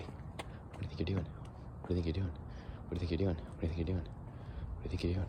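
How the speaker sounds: repeated soft spooky whisper at a cat; playful whisper. Pretend. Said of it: "it's so fun to do".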